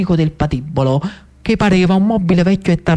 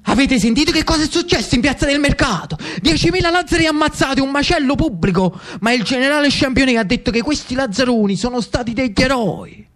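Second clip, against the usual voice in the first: higher, fast and breathless with bad news; agitated Jacobin messenger; 8 semitones higher than usual. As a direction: blurt out the massacre news in a rush. Giacobino brings grim news. (giacobino agitato che porta notizie terribili, voce alta e rapida)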